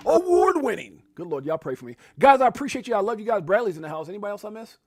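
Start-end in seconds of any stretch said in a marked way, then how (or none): 0.1-1.0 high booming hype voice, award pushed up; new announcer voice. Shown as a demonstration. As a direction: belt it out like a ring announcer. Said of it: "My throat gets all scratched up".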